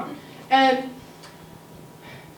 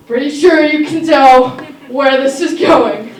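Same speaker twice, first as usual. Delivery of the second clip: loud, laughing tease to the audience; 3 semitones higher, 8 dB louder, slower than usual. Real, not acted. Telling audience the twist while laughing. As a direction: chuckle through the setup.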